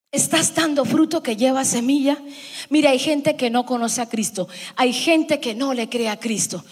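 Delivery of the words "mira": normal talking voice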